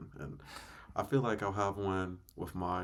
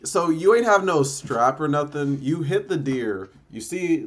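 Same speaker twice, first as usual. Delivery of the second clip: loud shocked disbelief; 7 semitones higher, 14 dB louder than usual. Real, not acted. Shocked by deer choking story.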